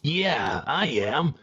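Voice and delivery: ordinary talk, nothing marked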